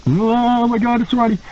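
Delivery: high, drawn-out mock awe; retold panic, pitched high; starstruck dread. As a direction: play a nervous rookie gasping at a star. Retells seeing his idol while nervous.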